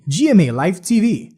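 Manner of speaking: ordinary talk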